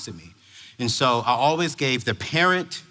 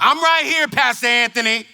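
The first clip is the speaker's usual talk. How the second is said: high, loud imitated mother; mock mom's holler; mom in the background; 7 semitones higher, 3 dB louder than usual. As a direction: call out from across the room. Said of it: "she's in the background".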